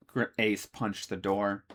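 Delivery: ordinary delivery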